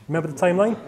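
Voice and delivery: usual speaking voice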